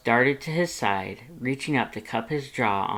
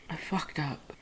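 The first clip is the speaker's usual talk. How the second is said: much softer, ashamed; quiet admission; 6 semitones higher, 8 dB quieter than usual. A narrator's reading. Red admitting his fault to Lust, quiet and ashamed. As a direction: mumble it out in shame.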